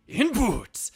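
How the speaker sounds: put-on loud punchline in a Spanish accent; Spanish Turo voice. Streamer pitches the second comic panel, hammy Spanish accent. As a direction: belt out the tag like a Spanish cat hero. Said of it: "kinda Turo close up saying".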